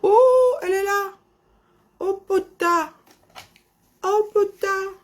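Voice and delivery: high startled exclamation; shocked, she's there after all; surprised. Real, not acted. Spots her colleague online.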